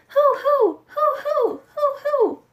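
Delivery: high owl hooting, chasing; owl hoots. A narrator's reading. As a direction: keep hooting as you chase him off. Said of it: "kept yelling louder and louder".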